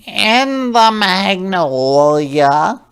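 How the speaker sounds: high, slow squeal of a panicked pig. Acted. Farmer pig squeals up at the tree, high pitched squeal. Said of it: "squeals Farmer Pig".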